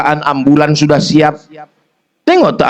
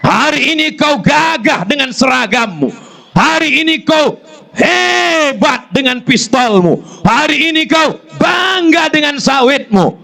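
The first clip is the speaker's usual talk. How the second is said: preaching at full force; 11 semitones higher than usual. Acted. Preacher reproaching husbands with a high, building list. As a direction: pile each clause higher.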